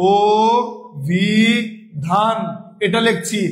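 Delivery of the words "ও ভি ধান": ও, ভি, ধান with clear pauses between; syllable by syllable. Shown as a demonstration. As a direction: break ও ভি ধান into "o... bhi... dhan". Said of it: "আমরা অটাকে কি উচ্চারণ করছি". (ও ভি ধান থেমে থেমে উচ্চারণ)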